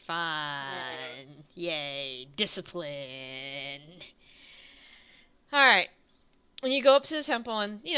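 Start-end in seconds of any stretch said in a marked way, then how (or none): 0.1-1.4 low, soft, drawn-out "fun"; joyless deadpan. Acted. Joyless reaction to monastery routine. 1.6-3.8 low, soft mock cheer; joyless deadpan. Joyless reaction to monastery routine.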